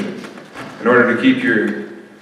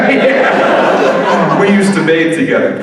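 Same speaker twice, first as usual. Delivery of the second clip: ordinary delivery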